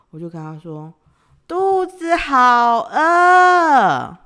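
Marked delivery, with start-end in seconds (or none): 1.5-4.2 put-on high, slow, drawn-out babyish whine; acting cute. To her boyfriend, a stretched-out spoiled whine for food. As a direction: whine like a spoiled little girl. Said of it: "我有稍微比较塞奶一点".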